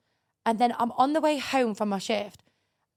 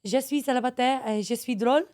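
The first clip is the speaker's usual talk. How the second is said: French phrase. Retold or imitated. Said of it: "Give me a line".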